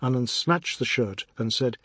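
ordinary delivery

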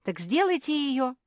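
high, loud order; bossy command to the others. A narrator's reading. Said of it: "велела Мафи".

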